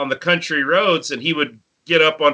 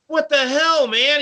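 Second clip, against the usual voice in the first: high, mock indignant yell; indignant outburst; 11 semitones higher than usual. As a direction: demand an answer, offended. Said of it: "and he's like".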